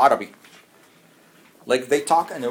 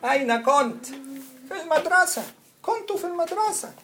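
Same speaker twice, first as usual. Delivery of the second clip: demo voice; 12 semitones higher than usual. Shown as a demonstration.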